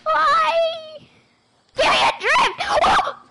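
high, loud anguished yelp; high wailing shout. Real, not acted. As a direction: wail out in despair.

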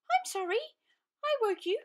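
high, small and frightened; a tiny mouse quaking with fear; trembling. Acted. Mouse apologizing to the woken lion, trembling and high. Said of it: "she said, trembling".

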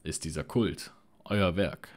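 loud accusing question; demanding shout. Acted. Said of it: "rief Arthas".

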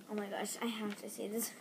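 soft unvoiced aside; breathy whispered thought. Real, not acted. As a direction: whisper it to yourself.

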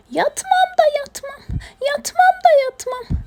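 high-pitched sing-song stubborn little hedgehog; stubborn hedgehog refusal. Acted. Stubborn hedgehog refusing bedtime, high obstinate chant. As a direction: whine it high and insistent, digging in. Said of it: "inat etti uyumamakta".

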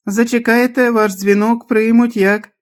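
very high, piping answering machine; high automated phone message; squeaky mechanical voice. A narrator's reading. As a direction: drone the recording in a squeaky pitch. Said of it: "Заговорив по механічному писклявий голос".

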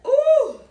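shriek. Real, not acted. Shriek at the spice.

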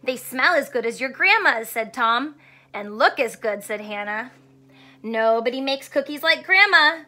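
put-on storybook read-aloud for kids; reading to children. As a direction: read it warmly to young listeners. Teacher reads picture book aloud.

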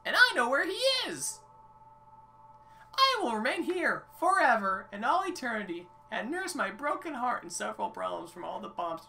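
high-pitched, melodramatic figure sending the hero away; dramatic game character. Acted. Game character dismissing the hero to the surface, melodramatic. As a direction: deliver it as a heartbroken, high-voiced exile.

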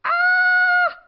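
high loud cartoon scream; falling scream. A narrator's reading. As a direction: shriek as they tumble down. Characters crash down hill.

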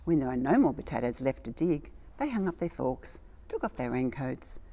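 put-on gentle read-aloud storytelling for children; storybook reading. A narrator's reading. Reading a story to little children, storybook voice. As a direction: tell it like a bedtime picture book. Reads a picture book aloud.

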